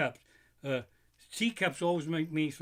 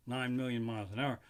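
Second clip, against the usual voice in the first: ordinary talk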